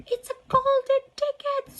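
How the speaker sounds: high, loud shopkeeper scream. Acted. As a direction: shriek it out in astonishment. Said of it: "screamed the shopkeeper".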